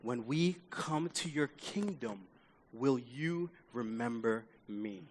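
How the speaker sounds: slow, very soft, humble asking; hushed plea. Acted. Dying thief asking Jesus to remember him, hushed plea. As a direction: barely voice it, slowly, sincerely.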